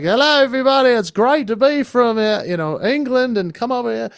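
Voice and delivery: high, loud, hammed-up drunk English accent; a ridiculously bad English accent, tipsy. Best man fooling wedding guests, in a bad English accent. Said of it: "I did this just ridiculously bad accent".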